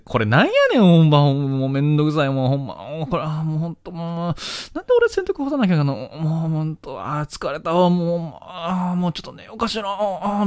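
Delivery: high, fretful Osaka auntie voice; nagging housewife. Retold or imitated. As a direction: grumble like a weary housewife. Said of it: "みたいな感じで言ってたら".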